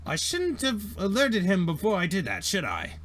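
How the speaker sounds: high, fussy robot apology; apologetic droid. Acted. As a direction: fret like a clumsy polite droid. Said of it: "And Dent is like".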